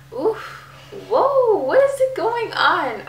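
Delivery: high, loud startled exclamation; sudden real surprise at welling tears; startled whoa. Real, not acted. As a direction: jolt up in surprise at yourself. Caught off guard by own emotion.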